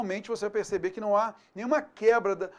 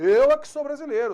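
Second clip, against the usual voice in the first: Eu é que sou brasileiro, é stressed, higher and louder; emphatic; 5 semitones higher, 6 dB louder than usual. Shown as a demonstration. Teacher demonstrating emphatic é que, higher and louder. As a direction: claim it proudly. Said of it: "eu quero enfatizar isso".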